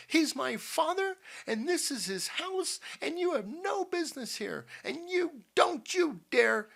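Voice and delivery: high-pitched, emphatic woman's outburst; triumphant defiance. A narrator's reading. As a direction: throw it at them in defiant triumph. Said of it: "finished Missus Dobson triumphantly".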